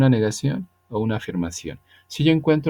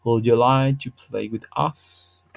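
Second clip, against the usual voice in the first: slow English demo; slower than usual. Shown as a demonstration. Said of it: "y digo".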